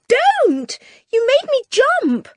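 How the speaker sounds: high, loud startled outburst; startled cry. Acted. Anne, startled by the screech, cries out high and loud. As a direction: jump out of your skin and snap it.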